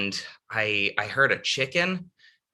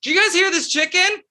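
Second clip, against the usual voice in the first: high, loud call to a crowd; yelled out; 7 dB louder than usual. Retold or imitated. Climber to everybody silently suffering on the mountain, yelled out. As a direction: holler it out to everybody. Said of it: "yelled out to".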